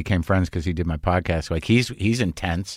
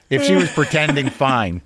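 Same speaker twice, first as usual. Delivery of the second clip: ordinary delivery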